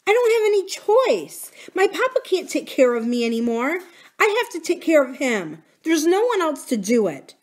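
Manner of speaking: high-pitched, distressed self-defence; upset insistence. Acted. Corinne explains to Buki she must help papa, distressed. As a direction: plead that nobody else can.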